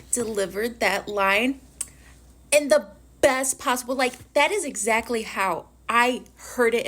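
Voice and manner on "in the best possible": normal talking voice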